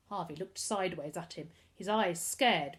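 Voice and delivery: usual speaking voice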